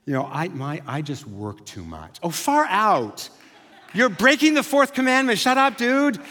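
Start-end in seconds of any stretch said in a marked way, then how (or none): 0.3-2.2 low, softer mock admission; sheepish confession. Churchgoer admitting a socially acceptable sin, sheepishly. 2.2-6.2 high, loud pretend scolding; mock outrage. Friend scolding a confessed workaholic, in mock outrage.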